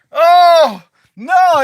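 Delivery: high, loud horrified "oh, no"; shocked cry. Retold or imitated. Reliving waking up back in his body, high shocked cry.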